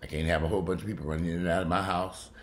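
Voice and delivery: ordinary delivery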